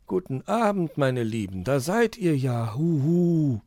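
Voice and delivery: put-on kindly uncle owl welcoming the children; friendly old owl. A narrator's reading. Onkel Uhu greets the children warmly with a hoot. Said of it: "begrüßte sie Onkel Uhu".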